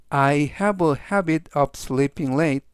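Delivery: very slow, each word separated; slow, clear reading. Shown as a demonstration. Teacher slowly reads the sentence three times for students. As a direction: read slowly so students can follow. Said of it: "제가 역시 천천히 세 번씩 읽어드리고요".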